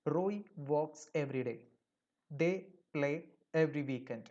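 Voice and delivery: very slow, word by word; slow example reading. Shown as a demonstration. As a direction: read the examples slowly for the class. Teacher reads example sentences. (പതുക്കെ വ്യക്തമായി വായിക്കുന്നു)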